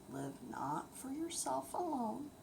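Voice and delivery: usual speaking voice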